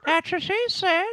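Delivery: very high, squeaky punchline; squeaky joke. Pretend. Repeating the joke to friends in a squeaky voice.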